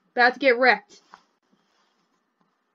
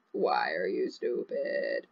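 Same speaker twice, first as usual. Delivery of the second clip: much lower, softer, slow mock-dumb voice; mock deep voice; 12 dB quieter, slower than usual. Player teasing her Sim in a goofy low voice. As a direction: drag it out low like a dopey goof.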